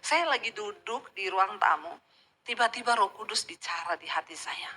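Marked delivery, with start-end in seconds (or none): 2.4-4.7 whispered, awed and intimate; hushed whisper. Real, not acted. Preacher recalling God speaking in her heart, whispered. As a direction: breathe it out as a secret moment.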